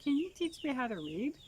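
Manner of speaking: put-on little boy asking. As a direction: ask sweetly like a small boy. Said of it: "he came and said".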